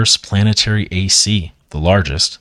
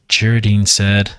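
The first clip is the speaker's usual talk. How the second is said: plain reading voice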